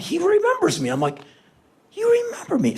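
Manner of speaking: overjoyed. Retold or imitated. Overjoyed soldier recognizes him.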